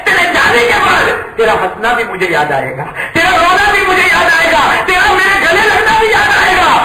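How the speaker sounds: rising to a high, loud wail; pleading, near-crying cry; tearful farewell. Acted. Father crying out his farewell to his son, high wailing.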